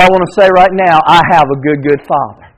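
normal talking voice

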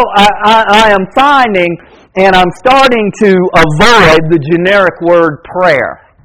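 ordinary talk

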